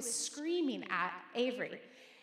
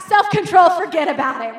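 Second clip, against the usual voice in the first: much louder, higher mock-furious shout; mama bear; 4 semitones higher, 21 dB louder than usual. Pastor recalling her mama bear fury, loud comic shout. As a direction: blast it like a mama bear losing control. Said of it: "the mama bear in me comes out".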